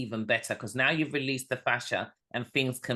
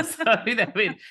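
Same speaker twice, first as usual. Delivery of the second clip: laughing; 10 semitones higher, 7 dB louder, faster than usual. Real, not acted. Laughing at the story.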